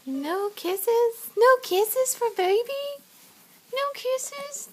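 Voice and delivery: put-on high sing-song baby talk. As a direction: coax a toddler in a high playful voice. Mother coaxing toddler, baby talk.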